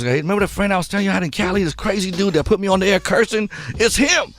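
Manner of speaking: excited, high, rushed retelling of a friend's words; eager introduction. Retold or imitated. Rapper eagerly presenting a radio friend to his wife, excited.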